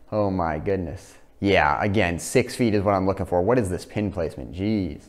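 ordinary talk, nothing marked

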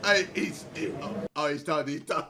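usual speaking voice

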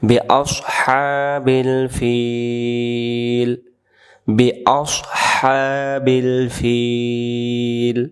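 very slow, drawn-out tajweed chant; Quran recitation. Shown as a demonstration. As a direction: stretch each syllable like recitation.

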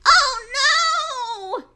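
very high, loud squeal. A narrator's reading. Piglet squealing in alarm at the door. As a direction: shriek like a scared piglet. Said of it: "squealed the piglet".

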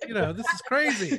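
laughing in disbelief. Real, not acted. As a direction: laugh through the words, amazed. Amazed host laughs at workload.

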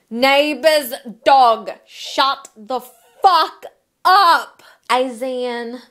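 slow, loud word-by-word shout; exasperated yell. Acted. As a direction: bellow each word separately at a barking dog. Re-enacting yelling at dog.

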